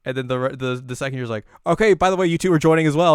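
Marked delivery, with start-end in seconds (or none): 1.7-3.1 high, loud declaration; bossy announcement. Retold or imitated. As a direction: declare it loudly as a done deal. Acted commanding announcement.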